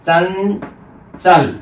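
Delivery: very slow "चंचल", first syllable held, then चल; nasal ञ demonstration. Shown as a demonstration. Teacher giving an example of ञ, "चंचल" slowly split. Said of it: "ञ की ध्वनि के रूप में उच्चारित करेंगे".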